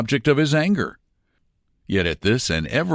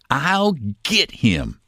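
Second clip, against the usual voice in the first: slow steady threat; 5 semitones higher, slower than usual. A narrator's reading. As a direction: let each word land slowly. Kid repeats his threat.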